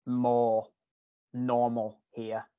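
slow, doubtful "normal" as if in quotes; air quotes on normal. Shown as a demonstration. Telling a friend about moving back, ironic slow "normal". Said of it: "normal in quotations".

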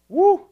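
high, loud preacher's whoop; excited whoop. Real, not acted. Preacher overcome before reading the scripture, excited whoop. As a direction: let out a sharp, high woo.

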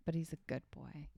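soft musing whisper. Real, not acted. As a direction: murmur it under your breath while thinking. Muses over the clue in whispers.

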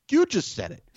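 high-pitched mock disbelief; incredulous protest. Retelling comeback to grandfather about his daughter, high incredulous protest. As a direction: throw it back at him, voice leaping high.

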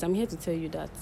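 normal talking voice

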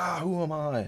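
high, riled-up mock clown voice; Buggy flipping out. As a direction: squawk it like an offended clown captain. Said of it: "Buggy flips out".

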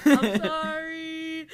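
slow, mock-tearful sorry; tearful apology. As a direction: drag out a small sobbing apology. [name] apologizing to hurt Dean.

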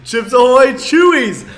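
loud, high, laughing answer. Real, not acted. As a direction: laugh out the words.

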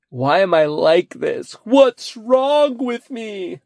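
high, slow self-pitying whine; mock sad. Feeling sorry for himself over a bite, mock sad. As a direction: wail it, hammed-up sorrow. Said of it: "You get so sad".

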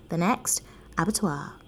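usual speaking voice

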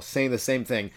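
usual speaking voice

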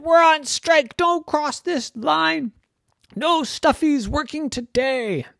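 squeaky high toy voice, fed up and defiant; tiny stuffed animal declaring a strike; high stuffy voice. Acted. Peluches gardent le piquet.

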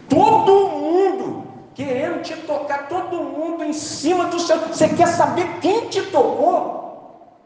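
agudo, lento e incrédulo; Pedro indignado. Retold or imitated. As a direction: pergunta alta de quem não acredita. Pedro indignado com Jesus. (Pedro pasmo, voz alta e arrastada)

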